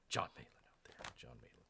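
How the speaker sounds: low hushed whisper of a villain's name; a playful sinister whisper; mock ominous whisper. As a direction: breathe the name like a secret threat. Naming the nemesis, whispered.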